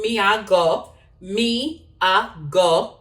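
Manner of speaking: slow, spaced-out Jamaican Patois. Shown as a demonstration. Vlogger teaching viewers a phrase, slow patois accent. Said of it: "I'll talk patois".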